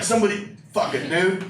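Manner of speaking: normal talking voice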